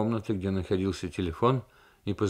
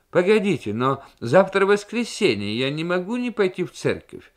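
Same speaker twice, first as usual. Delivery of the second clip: high, loud, crowing protest; rooster-like voice; 10 semitones higher, 8 dB louder than usual. Acted. Maltsen objects on the phone, high rooster-like voice. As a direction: crow it out like a rooster. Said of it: "петушиный голос Мальцена".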